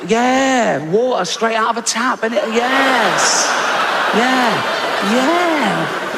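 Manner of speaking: hammed-up proud host showing a child the tap; mock excited show-off. Comic to adopted boy, hammed-up proud show-off.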